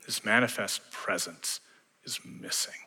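hushed, breathy whisper; whispered sorrow; dramatic whisper. Acted. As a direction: let it drop to a whisper of loss. Pastor's hushed lament over lost glory.

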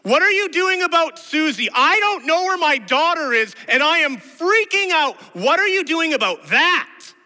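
frantic parent screaming, very high and loud; full-force, high-pitched desperate yell. Acted. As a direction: scream it out in panic for a missing child. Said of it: "And we scream out".

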